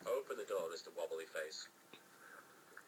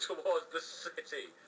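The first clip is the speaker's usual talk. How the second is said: plain reading voice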